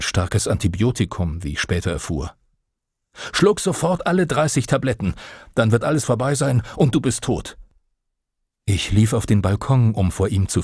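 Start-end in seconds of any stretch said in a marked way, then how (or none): 3.3-7.6 wütender Befehl. Acted. Father orders son take pills.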